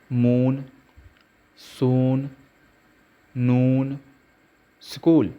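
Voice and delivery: long drawn "oo" in मून, सून, नून, स्कूल; long vowel. Shown as a demonstration. Teacher showing double-o words to learners, long held "oo" in मून, सून, नून, स्कूल. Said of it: "long sound देगा".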